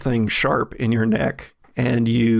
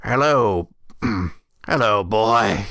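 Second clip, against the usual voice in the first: put-on old brother in wheelchair; 3 semitones lower than usual. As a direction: greet the boy as his much older brother.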